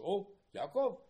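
high, loud cry of recognition, acted out; exclaimed greeting. Angels seeing Yaakov, acted as a loud exclamation. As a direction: call out in mock awe.

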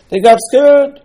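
very high, loud outburst; loud exclamation. Real, not acted. Preacher tells why the spies failed, loud exclamation. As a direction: cry it out high as the big reveal. Explains the spies' failing, says it twice.

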